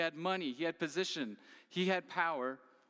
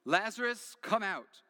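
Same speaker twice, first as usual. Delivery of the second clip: raised, loud call to the tomb; loud cry; 5 semitones higher, 4 dB louder than usual. Retold or imitated. Jesus calling Lazarus out of the tomb, loud cry. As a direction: call him out with full voice. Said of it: "he cried out with a loud voice".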